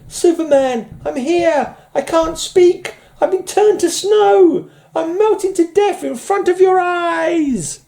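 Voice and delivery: wailing falsetto, slow and drawn out; hammed-up melting heroine pleading very high; desperate damsel. Reads Lois pleading as she melts.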